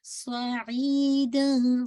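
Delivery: drawn-out सईदन, alif stretched two counts; Quran recitation demo. Shown as a demonstration. Teacher to student, drawing out सईदन slowly. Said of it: "सौ को heavy कीजिएगा".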